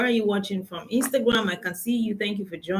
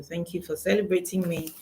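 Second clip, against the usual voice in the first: ordinary talk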